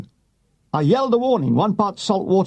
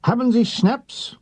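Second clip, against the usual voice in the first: slow, higher mock-German asking; a light German accent, slow and high; 7 semitones higher, slower than usual. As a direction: put on a slow, comic German lilt for the officers. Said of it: "Point blank asks them".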